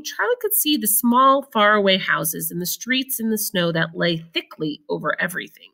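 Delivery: ordinary delivery, nothing marked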